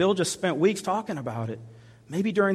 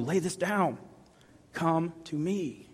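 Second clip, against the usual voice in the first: soft, slow and hushed; slow tender call; 3 semitones higher, 6 dB quieter, slower than usual. Acted. Christ tenderly calling someone fighting addiction. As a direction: draw them in slowly.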